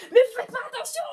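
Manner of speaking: high, loud yell at speeding cars. Retold or imitated. Girl splashed by cars, high yell at the drivers. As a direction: shout at the drivers splashing you. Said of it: "pourquoi tu cries".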